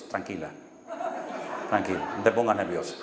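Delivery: soft, low and reassuring; calming her down. Retold or imitated. Pastor soothes a trembling church sister, soft and calm. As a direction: gently settle a nervous woman. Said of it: "Yo digo".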